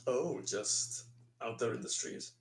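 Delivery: usual speaking voice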